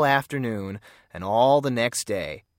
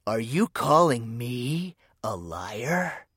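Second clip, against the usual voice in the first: menacing bully; much slower than usual. Acted. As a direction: lean in and threaten him slowly. Bully threatens the detective.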